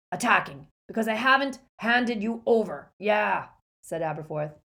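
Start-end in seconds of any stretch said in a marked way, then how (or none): 0.1-3.5 put-on loud cutting-in old man; gruff barman. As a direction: snap back like a grumpy innkeeper. Aberforth cuts in angrily.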